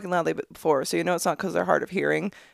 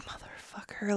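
whispered curse; 15 dB quieter than usual. Retold or imitated. Muttered curse in line.

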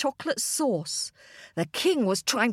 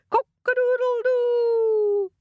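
long, high, loud rooster crow; high sustained cock-a-doodle cry; 12 semitones higher, 4 dB louder, slower than usual. A narrator's reading. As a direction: crow out loud and drawn-out to wake the sleeper. Rooster crows to wake king.

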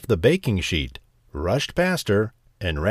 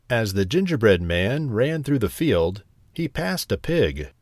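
ordinary delivery, nothing marked

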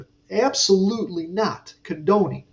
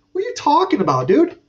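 high, louder, incredulous what are you talking about; baffled retort; 9 semitones higher, 4 dB louder, faster than usual. Retold or imitated. Coworker charging him, he shoots back high and incredulous. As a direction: sound thrown by the sudden attack.

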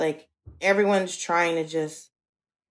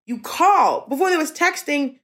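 exasperated laughing rant; 10 semitones higher than usual. Retold or imitated. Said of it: "I'm just like".

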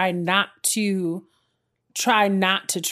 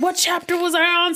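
high puzzled quote; 10 semitones higher than usual. Retold or imitated. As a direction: ask it in high dismay. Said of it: "So I was like".

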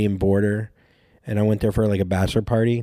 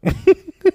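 burst of laughter; 6 dB louder than usual. Real, not acted. Laughing at own story.

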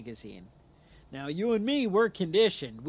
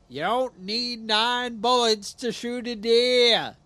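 loud, higher, drawn-out politician's declaration; slow, raised mock-politician accent; Cuomo impression; 5 semitones higher, 5 dB louder, slower than usual. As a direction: declaim it slowly like a hectoring politician. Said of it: "That was my Cuomo accent".

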